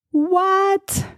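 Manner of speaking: sudden high squeak of surprise; mock surprised what. Coach amazed at her own point, high startled what. Mock shock at universe's reaction.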